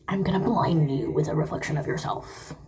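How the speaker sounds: low creature voice, unlike your own; Gollum. Retold or imitated. Retold reply to the apology texts, in a low Gollum voice. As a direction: slip into an accidental low creature voice. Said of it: "why did I sound like Gollum".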